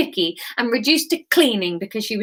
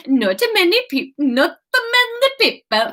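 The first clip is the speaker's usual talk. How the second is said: put-on botched Swedish accent; 8 semitones higher than usual. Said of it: "I don't know what that was".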